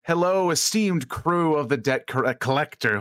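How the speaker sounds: slow, stately shipwide announcement; captain greeting the crew, drawn out; commanding captain's address. Acted. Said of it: "you are commanding, using your commanding presence".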